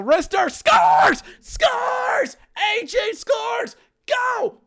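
high, shouted play-by-play; wild celebrating commentator yell; ecstatic goal call. Acted. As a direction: scream the goal with drawn-out words. Live goal call, teddy bears thrown.

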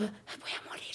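breathy whisper of mock doom; whispered dread. Lying in the MRI scanner, a whispered mock dread. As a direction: whisper it like a last thought. Inner thought inside MRI machine.

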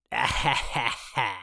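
eerie crone cackle; witch cackle. Acted. Soothsayer laughs in character.

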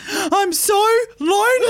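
imitating a crying friend, hammed-up; mock crying. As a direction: ham up a tearful wail, pitched high. Mimicking friend crying, high.

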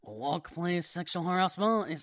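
slow, loud hammed-up French accent. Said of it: "in a French accent".

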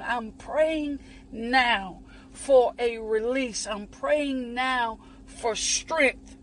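hushed, unhurried intercession; prayer. Acted. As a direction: pray it slowly over them. Leads a prayer.

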